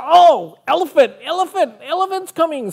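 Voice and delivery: fast, high alarm call; alarmed bee call. Retold or imitated. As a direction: sound the alarm as the little bee. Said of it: "and he said".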